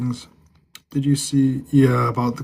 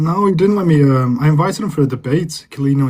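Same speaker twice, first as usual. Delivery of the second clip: higher, louder, cutting himself off; indignant outburst; 3 semitones higher, 6 dB louder than usual. Real, not acted. Streamer complaining an Instagram rival dodged his debate, indignant. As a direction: blurt it out, annoyed.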